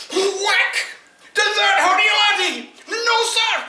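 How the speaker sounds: very high, loud laughing shout; wild shout. Acted. Sergeant yells at the soldier in the kilt, laughing and very loud. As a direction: yell it, cracking up.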